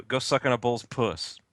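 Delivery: normal talking voice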